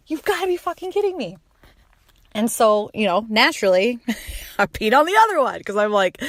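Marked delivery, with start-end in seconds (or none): 0.1-1.4 higher, rising shocked repeat; second time, pitch jumps in shock; shocked disbelief. Retold or imitated. Repeated shock at positive test.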